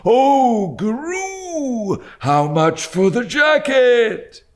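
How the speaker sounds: put-on high, slow sing-song old man's cry; a wailing old crank haggling. A narrator's reading. Old dealer asking the boy the price, wailing. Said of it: "cried the old man".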